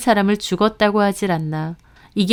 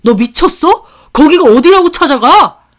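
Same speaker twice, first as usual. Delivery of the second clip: high, loud furious yell at his wife; sudden shout; 8 semitones higher, 10 dB louder than usual. Acted. Husband screaming at his wife for visiting his ex, loud and high. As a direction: scream it, snapping with rage. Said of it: "소리를 빽 지르더라고요".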